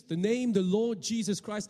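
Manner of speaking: ordinary talk, nothing marked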